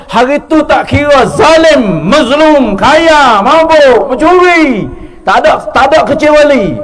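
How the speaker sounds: loud, high-pitched preaching cry; impassioned proclamation. Real, not acted. Preacher warning congregation that nobody is excepted that day, impassioned. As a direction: call out each kind of person at full force.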